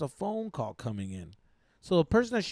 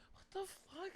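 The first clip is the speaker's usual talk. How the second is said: barely audible, high disbelief; mock hushed shock; 16 dB quieter than usual. Girlfriend seen through the window, mock hushed shock. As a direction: imitate her stunned reply, very soft. Her reaction behind the window.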